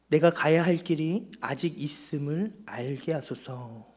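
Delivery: slow, deliberate prayer line for the congregation; slow prayer topic. Shown as a demonstration. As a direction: lay out each word slowly as a prayer. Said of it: "첫 번째 기도 제목입니다". (천천히 또박또박 기도 제목을 읽듯)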